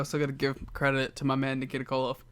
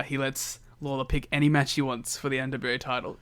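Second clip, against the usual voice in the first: ordinary talk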